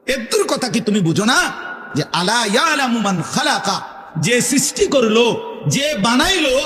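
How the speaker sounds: high, rising questions pressed on the crowd; sermon building to a challenging peak; fervent preaching. Acted. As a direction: push every question harder, climbing. Preaching at full force.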